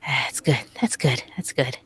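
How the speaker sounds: soft repeated mumble to self; quiet mumble. Real, not acted. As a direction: murmur it under your breath. Shy player mumbles to self.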